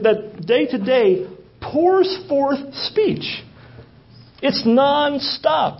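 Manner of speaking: slow rising preacher's emphasis on pours forth; slow emphatic stress. Acted. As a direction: stretch it out to show it never stops. Preacher stressing nonstop speech of creation.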